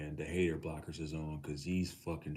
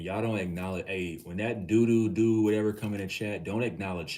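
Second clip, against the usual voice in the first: raised voice, pushing the chat along; rally the chat against the troll; loud urging; 4 semitones higher, 9 dB louder than usual. Real, not acted. Streamer loudly tells chat to ignore the troll.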